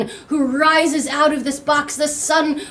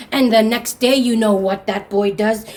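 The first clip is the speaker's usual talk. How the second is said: ordinary delivery, nothing marked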